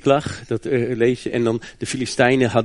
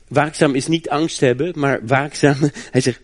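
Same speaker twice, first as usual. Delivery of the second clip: usual speaking voice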